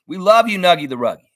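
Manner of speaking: high, loud cheer; excited shout-out. Real, not acted. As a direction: call out your love to a friend. Shout-out to friend in chat.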